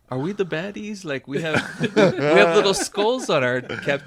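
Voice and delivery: a dawning, nervous realization; worried Nazi soldier. Retold or imitated. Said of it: "one of the Nazis was like".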